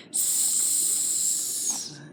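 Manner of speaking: "S" as a long voiceless hiss through the teeth; hissed S sound. Shown as a demonstration. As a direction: push air between the teeth: "S". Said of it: "Push that air right between your teeth".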